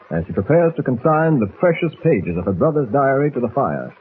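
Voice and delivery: ordinary talk, nothing marked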